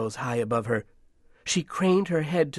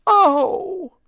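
piercingly high, loud wail; crying out; 9 dB louder than usual. A narrator's reading. Match girl losing her slipper, wailing out. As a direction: let out a loud, very high cry. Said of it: "cried the match girl".

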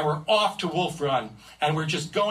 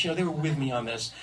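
plain reading voice

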